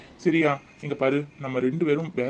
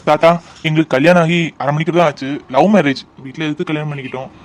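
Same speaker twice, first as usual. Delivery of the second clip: normal talking voice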